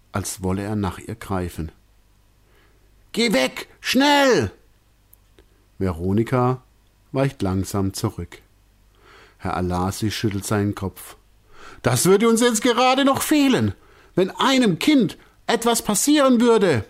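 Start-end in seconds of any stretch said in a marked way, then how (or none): 3.1-4.5 high, sharp alarmed shout; urgent warning. A narrator's reading. Director warning Veronika off the lion, high and urgent. 11.8-16.9 high, strained voice; shaken director. A narrator's reading. Director muttering his worry after the scare, high and strained.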